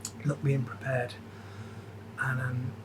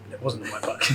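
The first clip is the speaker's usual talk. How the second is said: laughing; 4 semitones lower, 8 dB quieter than usual. Real, not acted.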